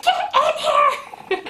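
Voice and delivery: very high, drawn-out mock shriek; retold scream. Labouring woman summons reluctant husband, drawn-out high shriek. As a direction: re-enact a slow, squealed summons. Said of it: "I started screaming".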